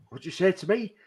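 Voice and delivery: imitating Scouse accent. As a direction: copy the kids' Scouse accent for a laugh. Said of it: "Doing their accent".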